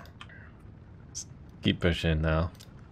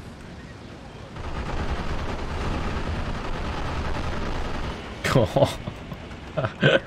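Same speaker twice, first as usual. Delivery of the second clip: airy wheezing laugh; long breathy laugh; 7 semitones higher than usual. Real, not acted. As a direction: laugh in disbelief. Laughs at overkill.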